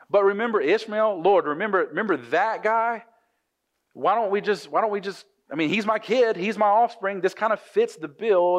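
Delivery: high-pitched, wheedling plea to the Lord; pleading. Retold or imitated. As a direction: act out Abraham bargaining, pitched well up. Acting out Abraham bargaining with God.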